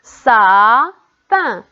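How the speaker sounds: "sapin" slow and loud, stretched sa, then pin; split into syllables. Shown as a demonstration. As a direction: pause between the two syllables of "sapin". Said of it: "la première ou bien la deuxième syllabe". (« sapin » découpé lentement : sa… pin)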